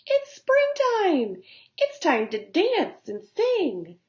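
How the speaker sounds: high, slow, airy grasshopper voice; playful bug laughing off work; carefree grasshopper. Acted. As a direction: tease the ant, lighthearted. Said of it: "just laughed and said".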